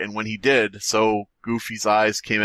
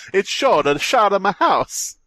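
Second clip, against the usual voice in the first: higher, louder dramatic repeat; mock dramatic spooky; 9 semitones higher, 4 dB louder than usual.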